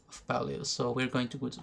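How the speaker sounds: ordinary talk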